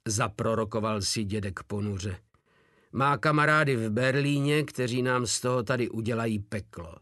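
plain reading voice

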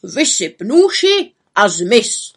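loud, slow stern command; harsh order. Acted. Forester ordering the woman to empty her basket, stern.

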